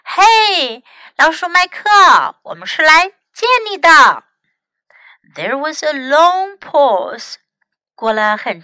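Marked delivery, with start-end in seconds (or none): 0.1-4.2 loud call toward the cabin; calling out, raised voice; shouting. A narrator's reading. Tim shouting to the rat in the cabin, loud. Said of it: "他就大喊道".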